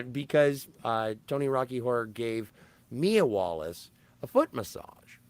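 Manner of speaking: normal talking voice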